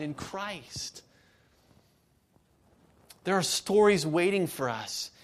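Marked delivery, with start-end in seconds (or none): none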